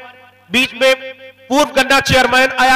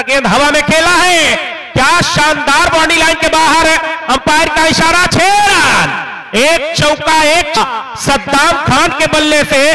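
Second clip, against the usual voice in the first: excited cricket commentary; 4 semitones higher than usual. Real, not acted. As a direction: call the six with a shout. Ball goes for six.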